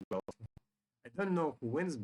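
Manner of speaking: ordinary talk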